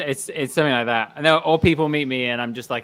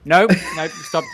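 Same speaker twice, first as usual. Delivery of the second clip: louder, higher nope nope stop; playful shout; 5 dB louder, slower than usual. Real, not acted. Rider cutting off his friend with a playful loud stop. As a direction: cut him off loudly.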